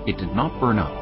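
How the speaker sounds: normal talking voice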